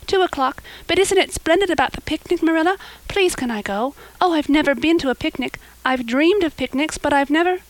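high, excited pleading; young girl bubbling over with hope; eager girl. Acted. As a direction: gush and beg to be allowed. Anne pleads to go.

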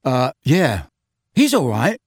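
put-on awkward, gruff boy; a touch brusque and uncomfortable. A narrator's reading. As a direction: answer with mild gruffness. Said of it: "said Ron gruffly".

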